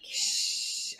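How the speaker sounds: unvoiced hush; a whispered shh to quiet her; shushing whisper. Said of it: "I'm like".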